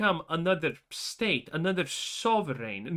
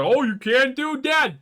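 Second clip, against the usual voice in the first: mock crowd; 7 semitones higher, 6 dB louder than usual. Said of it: "everyone in the Crimson Raiders is like".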